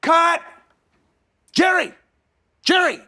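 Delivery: high, loud shouted call; director yelling. Retold or imitated. Director calling the boy on set, loud shout. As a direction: bellow the name across the set. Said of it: "Rob Reiner goes".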